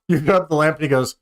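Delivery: ordinary talk, nothing marked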